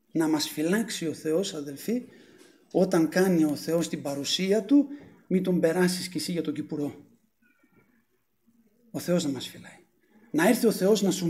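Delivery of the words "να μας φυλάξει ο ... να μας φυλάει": low, hushed pulpit aside; prayerful warning. Real, not acted. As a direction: softly plead for God's protection. Preacher lowers voice in prayerful warning. (χαμηλόφωνη προσευχητική προειδοποίηση)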